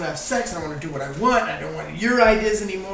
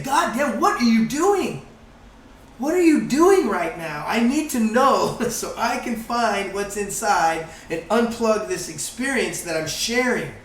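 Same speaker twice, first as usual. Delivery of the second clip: higher, louder, slow angry demand; honest anger; 4 semitones higher, 4 dB louder, slower than usual. Retold or imitated. Speaker voicing honest anger at someone, loud and slow. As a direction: confront them openly, angry but searching. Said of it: "I have to say".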